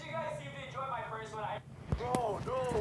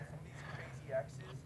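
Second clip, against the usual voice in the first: hushed warning whisper; 7 semitones lower, 7 dB quieter than usual. Real, not acted. Warning friends about crazy exes.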